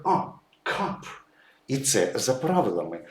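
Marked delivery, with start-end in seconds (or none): 0.0-1.1 «кап» said with an open «а» vowel; vowel read as «а». Shown as a demonstration. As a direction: say «кап» with a clear «а», as in closed syllables. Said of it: "читається як".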